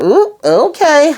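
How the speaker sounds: drawn-out high ooh, caught off guard; a slow, high "ooh" of shock; surprised ooh. Real, not acted. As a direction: stretch the ooh way up, startled. Own reaction to Alicent's threat.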